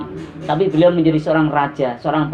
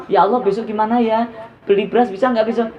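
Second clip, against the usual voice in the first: acted worry, pitched up; anxious fretting; 6 semitones higher than usual. Wife worrying about buying rice tomorrow, anxious fretting. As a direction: fret aloud in a mildly raised voice. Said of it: "Itu was-was".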